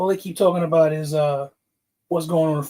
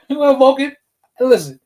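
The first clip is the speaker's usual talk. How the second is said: loud retort; 8 semitones higher, 8 dB louder than usual. Real, not acted. Answering a viewer's jab.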